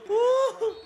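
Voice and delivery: high, long "woo"; excited whoop. Real, not acted. Reacting to the tight game. (mataas na hiyaw sa tuwa)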